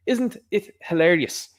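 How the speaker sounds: slow, drawn-out sarcastic question; dry sarcasm. Acted. Vlogger mocking the court case, slow and sarcastic. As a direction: let the irony hang, slowly.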